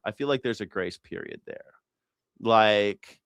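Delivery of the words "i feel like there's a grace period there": softer, musing repeat to yourself. Shown as a demonstration. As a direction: echo it quietly as an afterthought.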